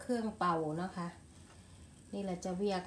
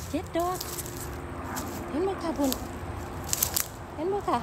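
excited; 5 semitones higher, 6 dB louder than usual. Real, not acted. Counts mushrooms while picking, excited.

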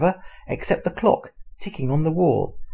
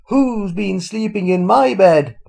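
higher, louder, slow Mother Bear; middle-sized bear voice; 6 semitones higher, 7 dB louder, slower than usual. A narrator's reading. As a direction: cry out in a raised Mother Bear voice. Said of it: "in her middle-sized voice".

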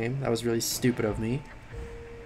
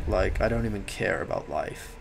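lightly done bored, couldn't-care-less voice; apathetic drawl; 3 semitones lower than usual. Shown as a demonstration. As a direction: sound like nothing matters. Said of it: "talking like this a lot".

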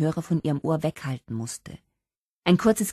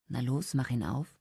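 low-key, softer nudge; quiet urging; 3 semitones lower, 7 dB quieter than usual. A narrator's reading. Gary nudging Holly to open the envelope, quiet urging. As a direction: softly prompt her to go on.

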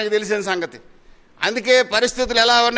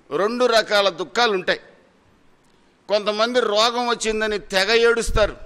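normal talking voice

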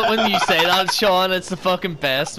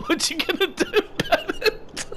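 cocky laughing challenge; 12 semitones higher than usual. Retold or imitated. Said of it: "proper confident and everything".

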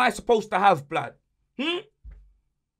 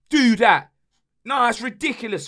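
normal talking voice

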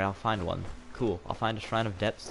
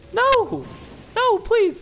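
very high, loud pleading cry; desperate high squeal; 7 dB louder, much slower than usual. Real, not acted. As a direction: squeal in panic. Boar escapes, desperate.

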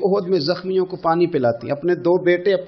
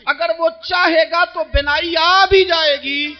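tearful preaching; 7 semitones higher than usual. Acted. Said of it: "preacher's emotional climax".

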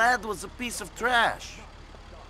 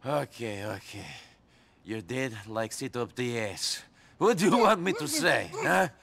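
ordinary talk

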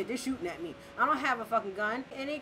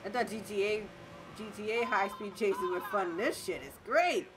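ordinary talk

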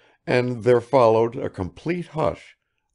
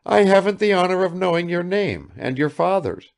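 put-on high, tearful old woman; through tears; 9 semitones higher, 4 dB louder than usual. A narrator's reading. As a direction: keep the tears in the voice. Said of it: "said the old woman through her tears".

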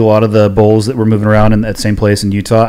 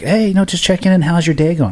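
higher, light sing-song check-in voice; bright little phone hello, imitated; cheery wife; 8 semitones higher than usual. Husband imitating his wife's chirpy check-in call. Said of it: "Jamie will call me and she's like".

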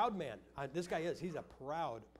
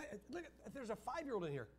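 ordinary talk

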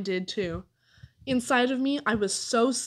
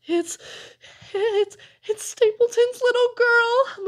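very high, loud, wailing moan; terrified moaning, stretched out; frightened moan; 13 semitones higher, 6 dB louder, slower than usual. A narrator's reading. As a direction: stammer it out in a high, slow wail. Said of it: "moaned Amos".

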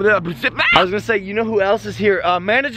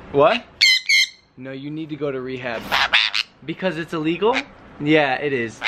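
low mock-serious voice lecturing a pet about rehab; deadpan lower voice, scolding the pig; talking to pet; 5 semitones lower than usual. Voicing conversation with pet pig Maverick.

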